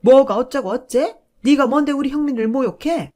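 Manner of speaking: 높고 아주 크게 내지르는 호통; 화가 치밀어 소리 지르듯; 격분한 고함. Acted. 시어머니가 격분해 소리침. (아들을 모욕당해 며느리에게 소리 지르는 시어머니)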